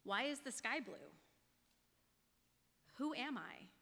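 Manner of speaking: ordinary talk, nothing marked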